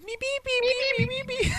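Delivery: very high squeaky muppet meeping; high-pitched rapid beeps; Beaker. Retold or imitated. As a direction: imitate a panicky squeaker. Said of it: "What a beaker".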